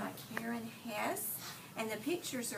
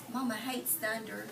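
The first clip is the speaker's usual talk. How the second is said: ordinary talk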